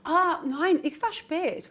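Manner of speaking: ordinary delivery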